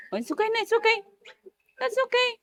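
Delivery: put-on very high baby-talk comforting; high-pitched soothing. Comforting a crying baby, high-pitched cooing.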